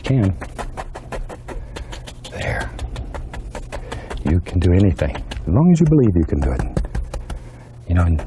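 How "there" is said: whispering. Real, not acted. Soft aside while painting.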